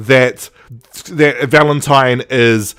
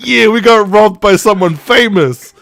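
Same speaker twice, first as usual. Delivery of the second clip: higher, louder delighted boast; gleeful brag; really happy; 6 semitones higher, 4 dB louder than usual. Retold or imitated. Nobles proud of their robbery, delighted and louder. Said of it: "really happy".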